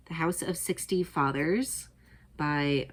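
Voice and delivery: ordinary talk, nothing marked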